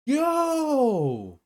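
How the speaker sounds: loud, high startled yell; shocked shout. Real, not acted. Streamer reacting to a shocking detail, loud. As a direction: blurt it out in shock.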